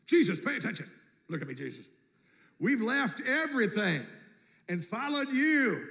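Peter insisting, pitched high and loud; demanding Peter. Retold or imitated. Peter demanding that Jesus pay attention. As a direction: make Jesus listen, pushy. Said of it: "Peter uses the imperative on Jesus".

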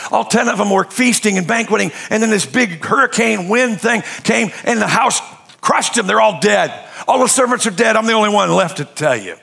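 high, loud, driving sermon delivery; preaching at full force. Acted. Pastor retelling Job's losses to his church, preaching hard. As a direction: hammer the story home to the congregation.

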